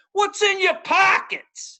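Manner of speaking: high mocking yell, slow and stretched; a taunting shout to the crowd. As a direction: bellow it across the line to mock the guard. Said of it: "I just yelled out".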